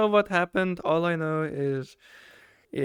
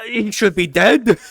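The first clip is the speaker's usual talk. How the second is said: excited exclaim; 5 semitones higher, 9 dB louder, slower than usual. Real, not acted. Exclaims over shocking wound.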